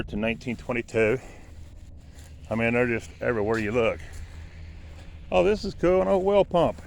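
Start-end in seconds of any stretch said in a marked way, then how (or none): 5.3-6.8 high and loud, thrilled; delighted discovery, calling out; excited. Real, not acted. Finds and works old pump.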